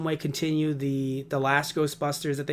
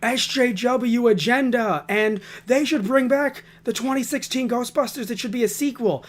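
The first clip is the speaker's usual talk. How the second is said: higher-pitched parody of people arguing; mock online complainers; 6 semitones higher than usual. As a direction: whine it like a caricature of angry commenters.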